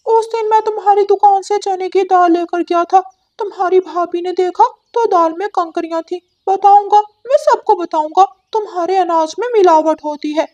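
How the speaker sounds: high, bright cartoon parrot voice; talking parrot; chatty parrot. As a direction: squawk it out like a cheeky parrot bird. Parrot threatens to tell.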